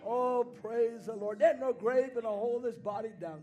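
loud, high shouted preaching; fiery pentecostal preacher at full force. Acted. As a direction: preach it out at the top of your voice.

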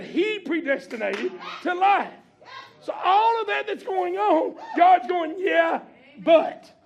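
loud, high, shouted sermon climax; preaching at full force. Real, not acted. Preacher to the congregation, shouted climax. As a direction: preach it out at the top of your voice.

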